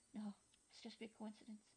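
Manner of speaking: frightened whisper to herself. Acted. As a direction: breathe it so no one hears. Hiding from approaching footsteps.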